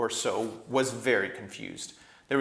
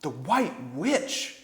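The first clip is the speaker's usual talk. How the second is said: cried out; 5 semitones higher, 4 dB louder, much slower than usual. A narrator's reading. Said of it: "cried three voices all at once".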